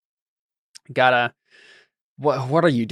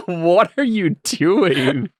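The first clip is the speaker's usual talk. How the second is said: baffled, slow, drawn-out question; puzzled and amused; confused; 4 semitones higher, much slower than usual. Retold or imitated. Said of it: "I was like".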